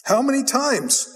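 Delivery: high, drawn-out exasperation; exasperated king. Acted. King fed up with the prophet Micaiah, exasperated. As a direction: demand it like you're fed up. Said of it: "interaction between the king and Micaiah".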